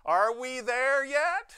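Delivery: put-on high, loud, drawn-out childish whine; whiny kid voice. Shown as a demonstration. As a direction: whine it like a bored kid in the back seat. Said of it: "we usually asked it with a whine".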